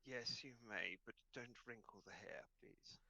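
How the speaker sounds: soft, fussy dog granting permission; lightly voiced haughty hound; vain dog. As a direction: grant it quietly, with aloof dignity.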